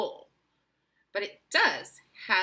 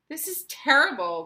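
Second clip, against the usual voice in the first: louder, distraught wail; 4 semitones higher, 9 dB louder than usual.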